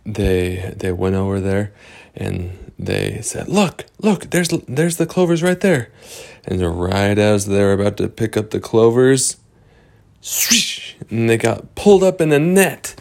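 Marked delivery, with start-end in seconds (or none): 3.5-5.9 high, eager voice pointing out the clovers; high excited character voice; excited discovery. Acted. 10.2-11.0 whooshing sound effect. Acted. Sound effect of net trap.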